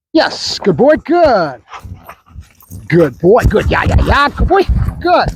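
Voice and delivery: reward the dog with your voice; dog praise. Real, not acted. Praising the dog after work.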